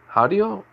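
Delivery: Hadio as a high, puzzled question; unsure pronunciation. Real, not acted. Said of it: "I cannot say the last name".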